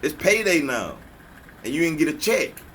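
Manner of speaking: ordinary talk, nothing marked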